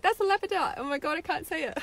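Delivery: high-pitched excited squeal of words; giddy excitement. Retold or imitated. Apologising for tripping over her crystal's name, excited. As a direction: get carried away, pitch jumping up. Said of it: "I got all excited 'cause I'm like".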